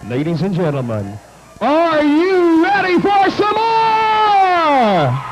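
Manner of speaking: slow, drawn-out arena call; ring announcer shout. Acted. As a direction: call it out over the music. Announcer shouting over music.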